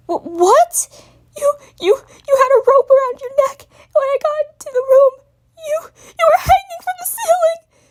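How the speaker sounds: high, loud stammering wail. Acted. Spike wailing to Twilight about the rope. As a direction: wail it out in agony. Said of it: "wail in pure agony".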